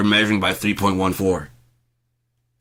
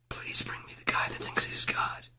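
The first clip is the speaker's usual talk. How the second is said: mock whisper; 10 dB quieter than usual. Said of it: "Act it out".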